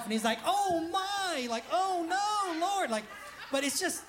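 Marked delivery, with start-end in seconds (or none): 0.4-2.9 high, drawn-out mock lament; dramatic Bible reader. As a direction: imitate an overdramatic audiobook reader. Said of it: "the way that the reader says it".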